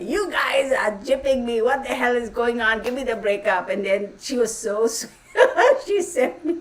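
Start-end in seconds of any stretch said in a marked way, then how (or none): 0.0-3.7 loud, accusing call to a company; angry complaint. Retold or imitated. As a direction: retell how you yelled down the phone. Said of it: "and I was like".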